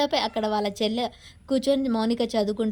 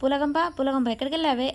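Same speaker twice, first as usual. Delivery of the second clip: mild narrated yell, pitched up; a touch of a husband's yell; calling out; 4 semitones higher than usual. A narrator's reading. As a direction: call out for her, lightly acted. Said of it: "అరుస్తూ ఉంటాడు".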